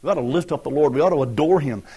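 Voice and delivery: ordinary talk, nothing marked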